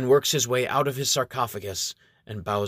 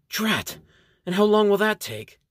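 exasperated outburst; 10 semitones higher, 4 dB louder than usual. Acted.

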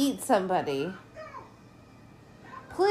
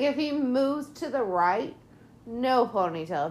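normal talking voice

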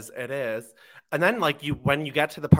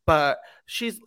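normal talking voice

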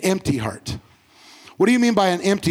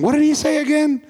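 higher-pitched puzzled question; puzzled listener; 8 semitones higher, 3 dB louder than usual. Retold or imitated. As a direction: imitate someone trying to recall, pitched up. Imagined puzzled listener days later.

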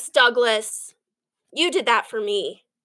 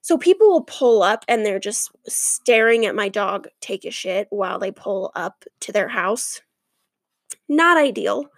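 ordinary talk

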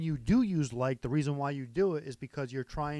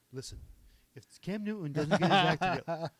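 a hint of a pushy TV pundit; pundit impression; 4 semitones higher than usual. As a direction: lecture like a sports talker. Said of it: "the listen guy who's like".

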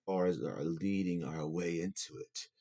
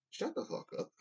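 whispered put-down; 4 dB quieter than usual. Real, not acted. Telling off online critics.

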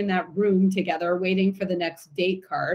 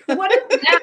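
usual speaking voice

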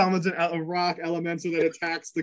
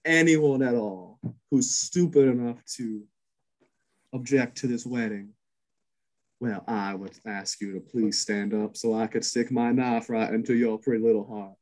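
menacing bride; 4 semitones lower than usual. Acted. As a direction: purr the threat like a sweet-faced killer. GM voices bride threatening objectors.

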